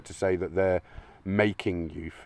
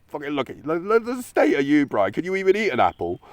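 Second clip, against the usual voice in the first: much higher, louder jeering mock of a mate; mate taking the piss; 8 semitones higher, 5 dB louder than usual. His mate jeering at his teeth, higher and louder. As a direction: rib him like a cheeky mate having a laugh. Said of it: "you would go".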